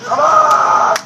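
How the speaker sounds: ordinary talk, nothing marked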